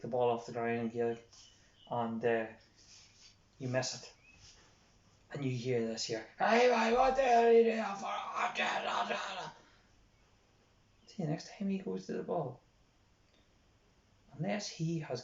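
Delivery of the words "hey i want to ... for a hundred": high, very loud drawn-out yell; full-blast sideline yell; berating shout. Acted. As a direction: bellow at a player who messed up. Berating coach acted out at player.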